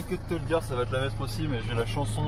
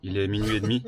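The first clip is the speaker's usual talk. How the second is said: diary narrator; 3 semitones lower, 8 dB louder than usual. Pretend. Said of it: "j'avais mon rôle et tout".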